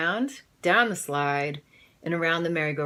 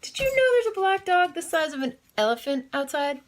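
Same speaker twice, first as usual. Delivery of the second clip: high, urgent call upstairs; yelled; 10 semitones higher, faster than usual. Acted. Mrs Hope yelling to Mr Hope, high and urgent. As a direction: yell it high to her husband. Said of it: "she yelled".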